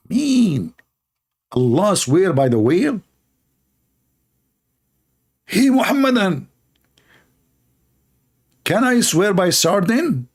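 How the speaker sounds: high mock incredulity; incredulous mockery. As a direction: scoff at the idea, pitching up. Ridiculing a whale oath.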